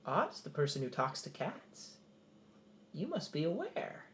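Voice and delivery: soft, lightly voiced cat; talking cat. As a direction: play a sly cat with a hint of a purr. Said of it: "Excuse me, Mister Cat".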